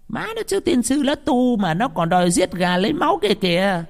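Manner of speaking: high-pitched indignant onlooker; gossiping villager. A narrator's reading. Villagers outside gossip about the monk, high indignant grumbling. Dân hiếu kỳ bàn tán.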